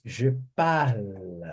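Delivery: Je parle said slowly, leaning on a clear final L; drawn-out Je parle, the L held; pron parle. Shown as a demonstration. Teacher stretching the L in parle for the class to copy. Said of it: "vocês vão repetindo aí".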